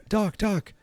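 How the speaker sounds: high, hurried imitation of a frantic teen; panicked Marty. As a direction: squeak it out like a kid in a jam. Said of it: "and he's like".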